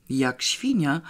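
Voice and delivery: usual speaking voice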